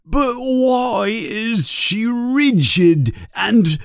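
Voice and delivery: high, drawn-out plaintive wail; distraught questioning. A narrator's reading. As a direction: plead for an answer in a high voice. Distraught owner questions the headmaster.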